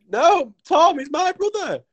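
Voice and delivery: very high mock protest; English dude. English dude gets up to insist, mock indignant protest. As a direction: protest high-pitched, hammed up. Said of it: "gets up and he's like".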